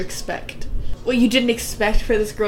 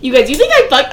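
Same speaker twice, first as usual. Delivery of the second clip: loud, higher, hammed-up protest; mock indignant yell; 4 semitones higher, 12 dB louder than usual. Replaying his denial for laughs, mock indignant yell. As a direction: shout it as a joking impression of him.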